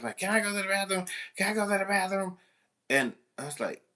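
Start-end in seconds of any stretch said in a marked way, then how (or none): 0.2-1.0 high, pleading student voice; mock whiny child asking permission; whiny kid asking. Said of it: "everybody has to go to the bathroom". 1.4-2.4 put-on high, faster pleading child voice; mock toddler nagging to go; whiny kid asking. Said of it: "like they're three-year-olds".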